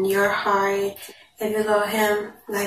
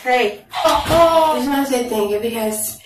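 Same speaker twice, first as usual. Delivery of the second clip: louder restart; 4 semitones higher, 6 dB louder than usual. Real, not acted. Mother speaking over her child to viewers, louder. Child interrupts, mom resumes.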